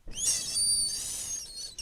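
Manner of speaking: drawn-out hissing "S-" like a snake; low, long whispered hiss. Shown as a demonstration. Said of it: "like a snake".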